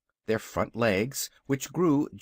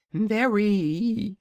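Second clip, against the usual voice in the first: high, proud little dragon voice; a boastful baby dragon, pitched high; young dragonette; 9 semitones higher, 4 dB louder than usual. Acted. Dragonette speaks to visitors.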